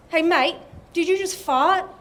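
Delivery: put-on old mate's question. Old man joke voice, bus stop.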